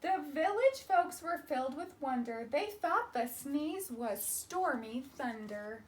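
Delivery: ordinary delivery, nothing marked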